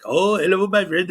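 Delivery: lightly put-on fake Indian accent, high and loud; mocking a fake Indian accent greeting. Streamer imitating an auditor's greeting, mock fake Indian accent. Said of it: "racist fake Indian accent".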